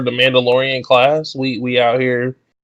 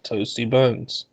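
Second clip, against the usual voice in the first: slow drawn out; 8 dB quieter, slower than usual. Shown as a demonstration. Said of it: "repeats phrase differently".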